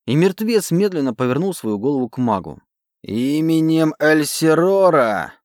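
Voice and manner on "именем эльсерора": slow, loud, commanding mage voice; commanding incantation. A narrator's reading. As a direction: intone the name with power. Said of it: "произнёс тот".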